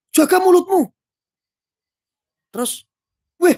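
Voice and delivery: high, loud warning snap; angry rebuke. Acted. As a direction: scold him sharply. Voicing an angry viewer comment. (menegur dengan marah)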